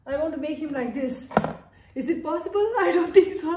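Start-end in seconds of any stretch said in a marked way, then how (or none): 2.6-3.6 higher, laughing through the words. Real, not acted. Owner laughing at her own grooming plan.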